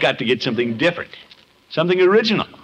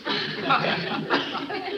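short breathy laugh, pitched up; amused laugh; 13 semitones higher, 8 dB quieter than usual. Acted. To Peavy after his joke, an amused laugh. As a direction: laugh off the teasing.

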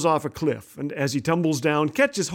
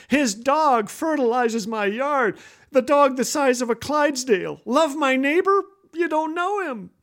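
higher-pitched, put-upon protest; mock complaint; 5 semitones higher than usual. Man to the Lord about neighbor, high mock complaint. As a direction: plead your case, pitch climbing.

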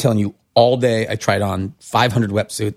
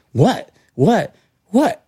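rising, higher, baffled repeated questions; confused what; 10 semitones higher, much slower than usual. Retold or imitated. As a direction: ask like a kid who can't see the joke. Everyone laughing at him.

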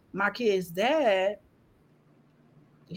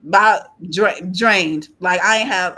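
ordinary talk

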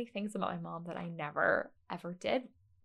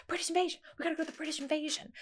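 imitating excited mom; 8 semitones higher, much faster than usual. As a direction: mimic mom urging everyone along. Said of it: "She'd, like, scream".

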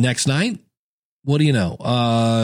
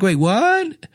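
baffled surprise; 8 semitones higher than usual. Retold or imitated. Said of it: "And you're like".

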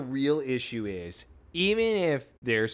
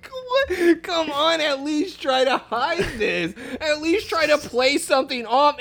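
high, squealing laughter through the words; can barely get it out for laughing; 13 semitones higher, 4 dB louder than usual. Real, not acted. Host mocking Clark's bad cover-up, laughing hard.

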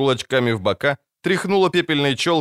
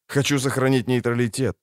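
ordinary delivery, nothing marked

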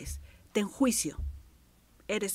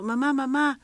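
put-on high, loud child calling for mum; a small child crying out for attention; 8 semitones higher, 10 dB louder than usual. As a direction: call out like a child wanting praise. Child calling parents for praise.

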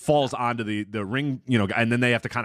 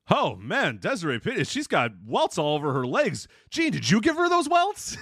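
mock Gorilla; 6 semitones higher than usual. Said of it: "Gorilla goes".

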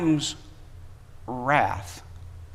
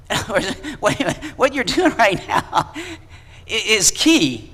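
laughing, pitched up through the words; 5 semitones higher than usual. Real, not acted. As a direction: break into a laugh mid-thought. Laughing aside mid-sermon.

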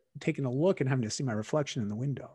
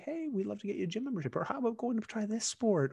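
imitating parents, higher and softer; light, careful suggestion in a raised pitch; gentle coaxing parents; 8 semitones higher, 5 dB quieter than usual. Parents offering their child help, gentle coaxing.

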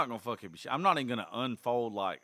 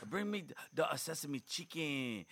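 higher-pitched mock Chinese accent; overplayed Chinese-restaurant accent; 5 semitones higher, 3 dB quieter, slower than usual. Dad ordering at a Chinese restaurant, overplayed mock Chinese accent. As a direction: overdo a bad Chinese accent. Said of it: "like, overplaying it now".

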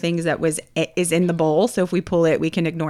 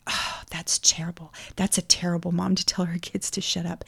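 hushed, scandalized mom; softly imitated disapproval; imitated disapproving whisper; 8 dB quieter than usual. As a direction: whisper it like a judgy bystander. Disapproving mother overheard, retold.

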